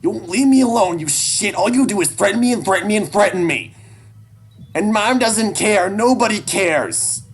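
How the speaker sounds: put-on very high, loud kid's tirade; high-pitched, distraught outburst; anguished screaming. A narrator's reading. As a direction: scream all your hurt at your father. Child's tearful tirade at father.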